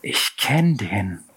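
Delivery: slow, thin little voice; a hint of a thin, unsure voice. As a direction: say it timidly from the back. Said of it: "mit dünner Stimme von hinten".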